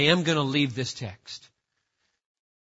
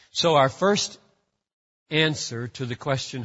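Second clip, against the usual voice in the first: ordinary talk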